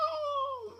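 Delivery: real high cackle of laughter; high burst of laughter. Real, not acted.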